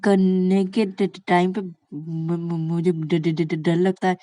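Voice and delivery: halting, syllables repeated over and over; fearful stammer. Acted. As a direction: stammer through a shy confession. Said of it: "इतना हकला क्यों रहती हो".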